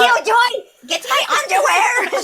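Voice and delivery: yelling across the room while packing; frantic Raze shout. Acted. Raze yells to Killjoy while packing in a panic.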